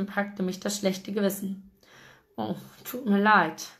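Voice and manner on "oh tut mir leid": a touch of sheepish remorse; apologize, mildly chastened; contrite apology. A narrator's reading. Guilty man apologizes to friend he woke, contrite. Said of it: "entschuldigte ich mich zerknirscht".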